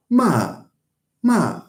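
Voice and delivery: MA starting high and falling sharply. Shown as a demonstration. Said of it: "except that this time it's".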